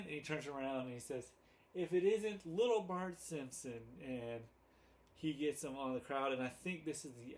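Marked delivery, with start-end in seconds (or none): 1.8-3.8 mock-triumphant Mo impression; voicing Mo. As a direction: gloat like you've finally caught the kid. Retelling Mo catching Bart.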